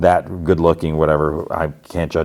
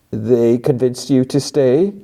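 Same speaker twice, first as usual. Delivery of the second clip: slow, higher put-on sneer; mock sneer; 6 semitones higher, slower than usual. She sneers at Mark for staying. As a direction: tease him in a put-on voice. Her mocking reply.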